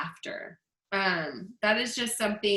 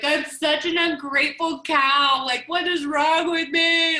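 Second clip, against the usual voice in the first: slow, loud, higher self-scolding outburst; self-reproach; 6 semitones higher, 7 dB louder than usual. Retold or imitated. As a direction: cry out at yourself, dismayed and exasperated. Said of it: "I was like".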